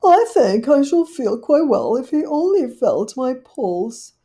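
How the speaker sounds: high, loud dismayed lady dog; fretting Duchess. Acted. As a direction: wring your paws over it. Duchess backs away.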